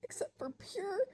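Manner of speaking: high, tearful voice breaking up; crying, voice dropping out mid-sentence; heartbroken. Real, not acted. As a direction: struggle to speak through real tears. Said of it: "I'm heartbroken".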